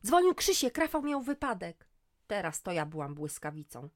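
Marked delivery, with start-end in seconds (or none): 0.1-1.9 much higher, loud alarmed cry. Acted. Daughter tells mother of brother's accident, alarmed. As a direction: blurt the bad news.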